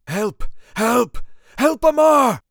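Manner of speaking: rising desperate shouts, high and loud; calling for help. A narrator's reading. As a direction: call out again, building to a full yell. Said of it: "I called again".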